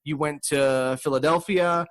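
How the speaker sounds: normal talking voice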